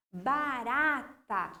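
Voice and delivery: "Barata" slowly, split ba-ra-ta; syllable by syllable. Shown as a demonstration. Teacher pointing out the strong syllable, "Barata" syllable by syllable. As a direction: separate the syllables of "Barata", leaning on RA. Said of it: "Ó, ra ali".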